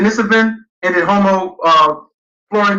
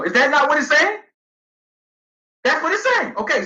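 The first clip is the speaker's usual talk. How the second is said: ordinary talk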